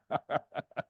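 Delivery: short, soft chuckle; amused little laugh. Real, not acted. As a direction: laugh softly at your own story. Preacher laughs at his own tale.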